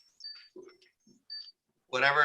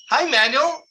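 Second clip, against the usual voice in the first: high, louder hello with a rising end; imitated eager greeting; 11 semitones higher, 5 dB louder than usual. Stranger greeting Manuel at the airport, imitated eager greeting. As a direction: play the keen fan greeting him. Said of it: "And then he goes".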